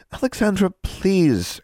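high-pitched imploring; pleading. Acted. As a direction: beg a friend to stop. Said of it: "Anna pleaded".

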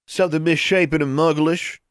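put-on high-pitched jeering boy's taunt. A narrator's reading. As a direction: jeer at them in a high character voice. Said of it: "jeered a voice behind them".

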